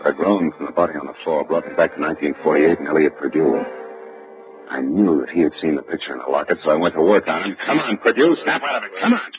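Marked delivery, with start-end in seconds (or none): 0.1-7.6 low, wry voiceover; noir narration. Acted. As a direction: tell it like a burned private eye. Detective narration over music.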